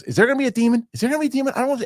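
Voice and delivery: high, squeaky mock panic; scared wife. Husband mimicking his scared wife during the demon scene. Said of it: "my wife goes".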